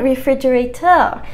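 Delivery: "refrigerator" in a French accent, rising at the end; French-accented "refrigerator", last syllable lifted. Shown as a demonstration. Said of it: "Just refrigerator with a French accent".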